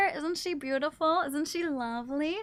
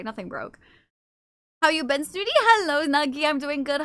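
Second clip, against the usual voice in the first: ordinary talk, nothing marked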